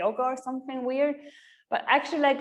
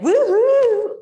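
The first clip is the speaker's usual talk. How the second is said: high, loud whoop; teacher hype; 12 semitones higher, 11 dB louder than usual. Retold or imitated. Teacher whooping at her class, loud and high. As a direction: cheer the class on with a big whoop. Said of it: "when you teach, you're like".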